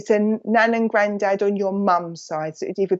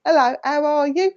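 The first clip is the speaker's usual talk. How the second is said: cheery greeting; 8 semitones higher than usual. Retold or imitated. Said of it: "that energy when it's a bit like".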